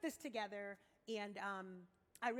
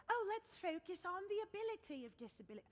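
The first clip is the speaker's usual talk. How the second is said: higher-pitched put-on British voice; mock British accent; 8 semitones higher than usual. Singer mimicking people who say things like this, mock British accent. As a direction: parody well-meaning people in a British accent. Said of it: "I don't know why that became British".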